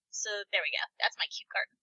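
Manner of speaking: hushed, breathy finish; whispering. Acted. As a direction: whisper it like a secret. Whispered sign-off to her reveal.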